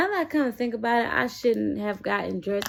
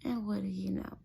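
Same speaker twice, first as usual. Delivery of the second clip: whisper; 10 dB quieter than usual. Real, not acted. Building suspense in story.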